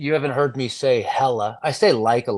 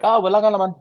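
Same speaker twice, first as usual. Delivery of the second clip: higher, louder Tagalog exclamation; raised Tagalog-accented reply; 8 semitones higher, 5 dB louder, much faster than usual. Acted. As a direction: jump up into a lively Filipino lilt. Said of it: "a way of, of speaking".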